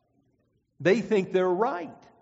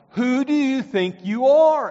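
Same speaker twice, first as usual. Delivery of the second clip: louder, higher retort; mock indignant challenge; 7 semitones higher, 8 dB louder than usual. A preacher voicing the crowd's indignant challenge. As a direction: throw the question back at him in scorn. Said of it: "They're asking him".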